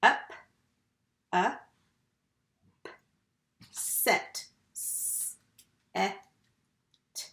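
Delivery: each syllable, then its separate sounds; sounded out. Shown as a demonstration. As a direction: tap out upset sound by sound with long gaps. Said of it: "we would tap that word".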